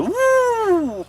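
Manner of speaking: long high mock howl; monster howl. Man scaring the girl with a monster howl. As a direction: howl like a made-up beast. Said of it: "وبيهجم بشكل دائري كده".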